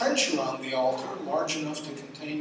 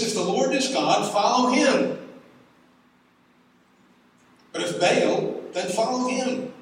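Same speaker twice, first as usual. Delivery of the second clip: higher, louder prophet's call to the crowd; ringing challenge; 7 semitones higher, 6 dB louder than usual. Acted. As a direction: challenge the whole crowd at full voice. Elijah's challenge to the people.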